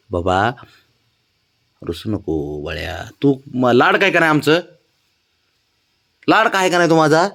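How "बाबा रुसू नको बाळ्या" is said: soft coaxing. Real, not acted. Soothing sulking pet.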